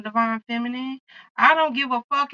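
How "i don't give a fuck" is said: a bit higher and louder, declared out loud. Shown as a demonstration. Reader defiantly telling viewers in her loud voice. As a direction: say it in your loud voice. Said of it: "in my loud voice".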